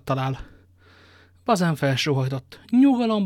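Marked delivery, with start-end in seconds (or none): 2.7-3.2 calling out. Acted. D'Artagnan calms sighing Bazin.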